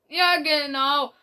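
high, loud, slow sarcastic drawl; mock the claim with a sneer; amused mockery. Tannenstern sneering at the other leaders, amused and loud. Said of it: "miaute Tannenstern belustigt".

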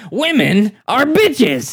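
slow, high mock rant; comedian rant. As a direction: drag it out like a standup rant. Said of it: "he made eight specials".

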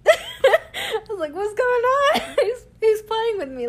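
high, loud, laughing through the words; cracking up while retelling the panic; laughing hard. Real, not acted. Laughing while telling her brother about the dead car.

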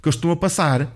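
retold sweary, exasperated demand; mock exasperation. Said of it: "Ele assim começa a se exaltar".

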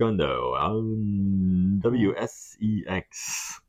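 slow, drawn-out DJ welcome; sexy radio voice. Acted. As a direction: stretch it out like a seductive radio host. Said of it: "that sexy radio voice of his".

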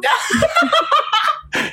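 squealing laugh, way up high; high laughing fit. Real, not acted. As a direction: burst into helpless laughter. Laughing at Chipre libre.

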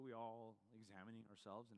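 ordinary delivery

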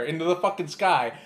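ordinary delivery

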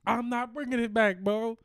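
refusing. Retold or imitated. Clerk refusing, loud.

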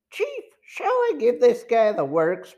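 high-pitched thug asking the boss; eager henchman. A narrator's reading. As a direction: pitch it up like a henchman itching for orders. Henchman asks chief to use force.

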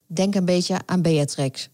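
ordinary talk, nothing marked

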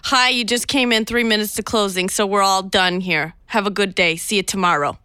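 brusque New York teller. Acted. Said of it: "I'll be a New Yorker Citibank".

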